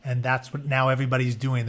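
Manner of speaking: normal talking voice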